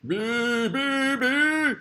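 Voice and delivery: a silly high buzzing bee cry. Acted. Buzzing word play climax.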